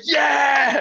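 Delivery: very high cheering yell; triumphant shout. Retold or imitated. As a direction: cheer like a fan at the show's end. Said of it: "I was like".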